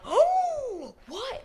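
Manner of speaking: sharp gasp into very high hoot; gasp, then squeal in overdone disbelief; mock gasping shock. Guys reacting while girls watch, mock gasping shock. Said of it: "if you're gasping".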